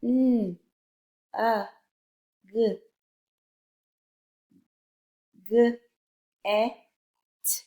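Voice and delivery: N, a, g, g, e, t sounded singly with pauses; separate letter sounds. Shown as a demonstration. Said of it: "say the letter and sounds".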